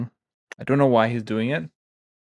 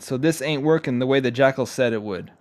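usual speaking voice

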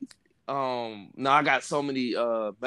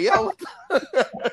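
higher, slow, drawn-out shock; slow stunned disbelief; 3 dB louder, much slower than usual. Retold or imitated. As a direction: stretch the disbelief out.

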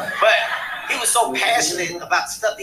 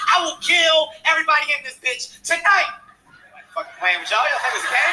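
loud, higher mock-threatening shout; mock tough guy; 8 semitones higher, 3 dB louder than usual. Comic pretending to be a tough guy threatening the club, mock shout. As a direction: bark a fake threat at the room.

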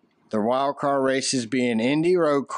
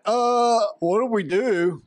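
higher-pitched mock-bewildered crew; dumbfounded crew; 7 semitones higher, slower than usual. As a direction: imitate a crew at a total loss. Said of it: "They're like".

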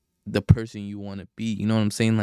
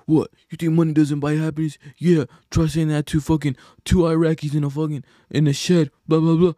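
higher, louder sneering rich-guy voice; mocking a rich snob; 6 semitones higher, 5 dB louder than usual. Mocking rich guys who dismiss money talk, higher louder sneer. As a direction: lampoon a smug rich man, pitched up and loud.

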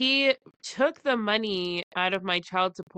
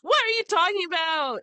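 high, louder, disbelieving; incredulous retort; 10 semitones higher, 4 dB louder than usual. Retold or imitated. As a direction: squeak out the disbelief, pitched way up. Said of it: "I was like".

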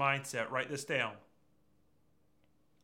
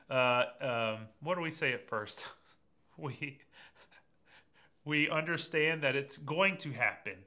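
normal talking voice

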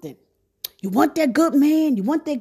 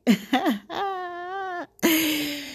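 real amused laugh; drawn-out, softer chuckle; 3 semitones higher, 6 dB quieter than usual. Real, not acted. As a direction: laugh at your own joke. Laughs at own bills joke.

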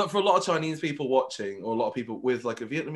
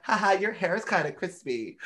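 light tease; 6 semitones higher than usual. Retold or imitated. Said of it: "I can't just be like".

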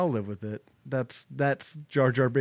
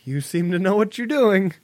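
meek compliance; 7 semitones higher than usual. Retold or imitated. Crew meekly obeying Hera.

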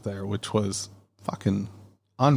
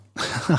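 short laugh; 5 semitones higher than usual. Real, not acted. Amused at pronunciation complaint.

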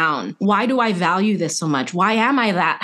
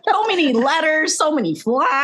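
laughing; 9 semitones higher than usual. Real, not acted. Laughing echo of friend.

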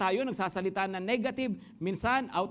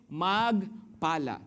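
emphatic answer; 3 semitones lower, much slower than usual. Shown as a demonstration. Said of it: "ano ang dapat gawin?".

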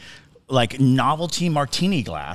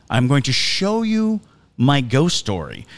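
slow, deliberate echo of a remembered remark; ringing in the head; 3 dB louder, slower than usual. Retold or imitated. Nervous guest replaying the host's promise, slow and portentous. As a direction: spell it out slowly, with weight. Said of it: "it's just ringing in my head".

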